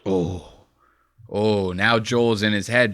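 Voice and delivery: ordinary delivery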